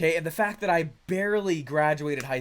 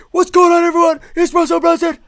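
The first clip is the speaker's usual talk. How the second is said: much higher and louder hyped-up podcast host intro; Russell Brunson hype intro; 12 semitones higher, 14 dB louder than usual. Retold or imitated. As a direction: shout it like an over-excited show opener. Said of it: "be like".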